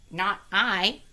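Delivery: the duck refuses, loud; duck's refusal. Acted. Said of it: "said the duck".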